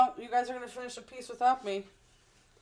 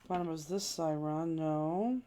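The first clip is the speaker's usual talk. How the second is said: usual speaking voice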